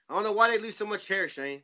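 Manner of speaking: usual speaking voice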